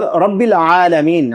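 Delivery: رب العالمین slowly with a clear ع; careful Arabic reading. Shown as a demonstration. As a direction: stress the ع of العالمین. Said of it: "عین".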